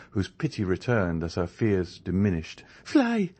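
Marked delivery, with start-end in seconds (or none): 2.8-3.3 much higher, louder urging shout; urging cry. A narrator's reading. D'Armilly urges Andrea to run, crying out. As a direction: push him to escape. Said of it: "cried Mademoiselle d'Armilly".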